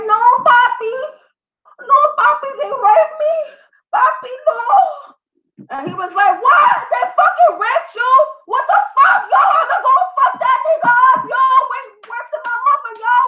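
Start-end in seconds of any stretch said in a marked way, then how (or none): 0.1-5.7 high, whiny mock crying; imitating a sobbing, pleading girlfriend; mock tearful plea. Acted girlfriend pleading to her man. 6.4-13.2 high-pitched, hammed-up furious outburst; incredulous mock shouting; mock outrage. Acted outraged boyfriend reacting to accusation.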